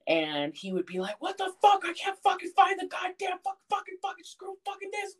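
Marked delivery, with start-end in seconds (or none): 1.2-5.2 very high, cursing tirade, imitated; grandpa's outburst. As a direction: act out a sputtering meltdown. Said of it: "he would literally have these outbursts".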